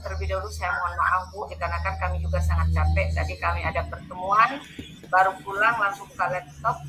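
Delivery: usual speaking voice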